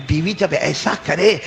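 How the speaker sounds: ordinary talk, nothing marked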